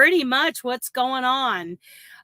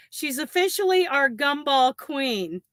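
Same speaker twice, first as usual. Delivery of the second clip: normal talking voice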